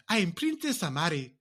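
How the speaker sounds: high, put-on African accent; mock royal with a bad African accent; fake African accent. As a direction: announce yourself in a phony African accent. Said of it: "them fake ass African accents".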